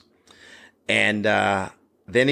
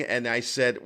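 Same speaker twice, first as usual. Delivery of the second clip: usual speaking voice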